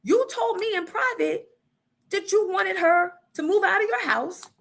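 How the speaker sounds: high, lightly puzzled therapist; imitating therapist. Therapist questioning the husband, voiced high and puzzled. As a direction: play the confused therapist. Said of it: "So the therapist goes".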